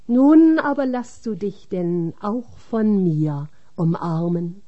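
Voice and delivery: ordinary delivery, nothing marked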